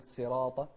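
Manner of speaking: صراط with a thin plain s, "siraata"; sad turned sin. Retold or imitated. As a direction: lighten the s of صراط. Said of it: "ما نقول". (صراط بسين بدل الصاد)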